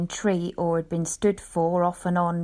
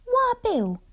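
much higher wife's voice; little wife. Acted. Wife greeting her busy husband, high woman's voice. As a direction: pitch right up for the little woman. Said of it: "says my little woman".

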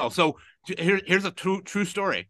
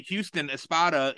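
ordinary talk

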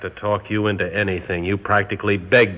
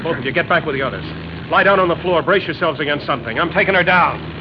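raised, commanding call over the noise; urgent orders; 8 semitones higher, faster than usual. Acted. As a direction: bark out crash orders fast. Pilot orders crash positions.

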